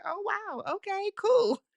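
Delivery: high, put-on casual voice; imitating Eddie. Imitating Eddie reacting to getting first chair, high put-on voice.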